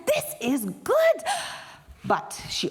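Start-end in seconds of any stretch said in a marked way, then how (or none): 0.1-1.2 delighted. Acted. Washu amazed at taste.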